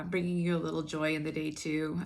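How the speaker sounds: ordinary talk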